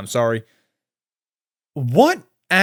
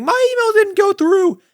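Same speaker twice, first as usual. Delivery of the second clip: much higher, whiny imitation; mock complaining fan. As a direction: parody the confused viewers. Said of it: "a lot of people saying".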